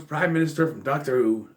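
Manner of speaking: ordinary talk, nothing marked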